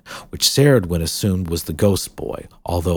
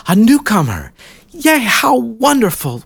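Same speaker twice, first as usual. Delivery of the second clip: delighted; 7 dB louder than usual. Acted. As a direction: greet the newcomer with bright delight.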